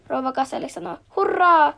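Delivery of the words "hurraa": put-on high, loud children's hurray; loud happy hurray for the ambulance; cheering shout. A narrator's reading. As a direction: cheer it out like the kids. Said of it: "lapset huutavat".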